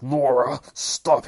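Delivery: high, loud command; desperate shout. Retold or imitated. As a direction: yell at her to stop. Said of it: "He's like".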